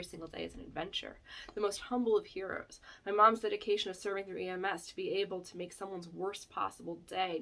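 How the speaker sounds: soft sincere reading aloud; emotional reading. Real, not acted. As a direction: read it close to tears. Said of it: "I cried last time I read this".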